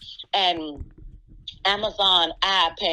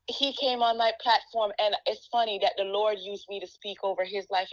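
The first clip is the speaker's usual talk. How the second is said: ordinary delivery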